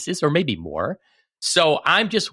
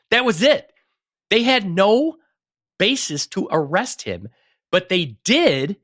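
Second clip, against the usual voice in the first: indignant; 8 semitones higher, 4 dB louder than usual. Real, not acted. Outrage at arrest without cause.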